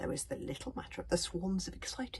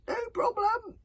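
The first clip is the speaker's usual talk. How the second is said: much higher, louder call over the noise; shouted back; 7 dB louder than usual. A narrator's reading. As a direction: shout it back mid-chase. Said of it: "Hiccup shouted back".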